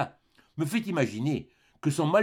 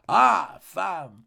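acted cry from the husband; 3 semitones higher, 4 dB louder than usual. A narrator's reading. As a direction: burst out, then fall away. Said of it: "le mari s'écria".

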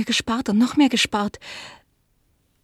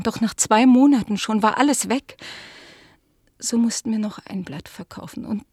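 ordinary talk, nothing marked

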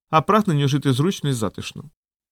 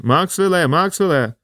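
higher, urgent repeated call; mild yell, raised in pitch; shouted call; 5 semitones higher, slower than usual. A narrator's reading. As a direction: keep calling for an answer, restrained. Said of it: "закричав він".

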